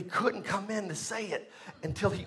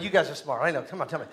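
usual speaking voice

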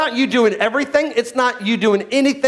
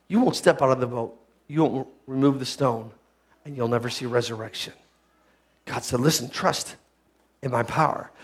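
much lower and softer; hushed preaching; 10 semitones lower, 7 dB quieter than usual. Acted. Preacher to congregation, dropping to a hush. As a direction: draw them in with a hush.

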